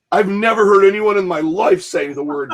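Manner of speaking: incredulous new guy. Retold or imitated. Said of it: "And he goes".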